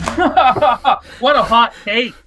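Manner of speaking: laughing, slow and drawn out. Real, not acted. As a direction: laugh through the words.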